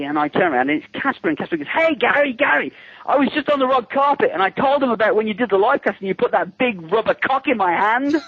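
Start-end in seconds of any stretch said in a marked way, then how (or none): 1.7-8.2 high, excitable hail across the seats; imitated excited calling. Excited actor calling across a packed premiere, high mock hail. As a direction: mimic an overexcited friend, pitched way up.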